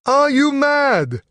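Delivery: high, loud, slow incredulous shout; disbelieving cry from a different voice; incredulous outburst. Acted. Captive Brugh objects.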